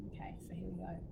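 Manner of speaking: soft unvoiced whisper. Real, not acted. Speaker quietly cueing viewers into the chant, whispering. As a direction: hush it down before the chant. Hushed lead-in before chanting.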